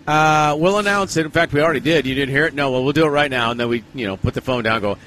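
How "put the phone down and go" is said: ordinary talk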